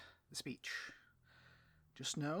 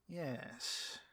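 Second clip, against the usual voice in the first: ordinary talk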